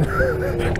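short wheeze of real laughter; breathy laughter. Real, not acted. Storyteller recalling the cable replays, wheezing laugh. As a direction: chuckle helplessly before the punchline.

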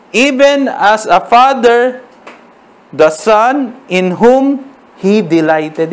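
very slow, solemn verse reading; scripture reading. Acted. Pastor reading the verse slowly to the church. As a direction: let each word land slowly.

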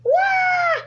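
high, loud wail; startled scream. Real, not acted. Player crashing while chased by cops, high loud scream.

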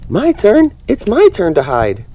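high, loud excited outburst; high gleeful shout of turn-taking; eager cry. Acted. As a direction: burst out like a kid who can't wait. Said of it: "Dory cried".

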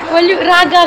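normal talking voice

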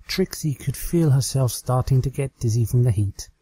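usual speaking voice

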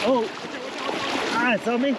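sudden high startled cry; a fish just landed on her, yelp. Real, not acted. Angler startled when the fish lands on her, surprised yelp.

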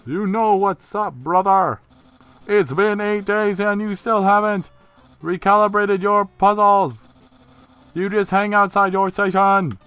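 high, booming cartoon scolding; loud brother character. Skeleton scolding his brother over puzzles, loud and high. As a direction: shout it high and loud, full of bluster. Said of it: "that's how he talks, definitely".

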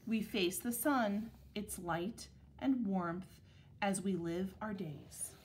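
measured picture-book reading with clear pauses; unhurried, carefully articulated storybook narration; read-aloud to children. Acted. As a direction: read it to second graders, phrase by phrase. Reads picture book to second graders.

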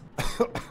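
short real cough after a fright; choking cough. Real, not acted. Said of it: "That made me choke on air".

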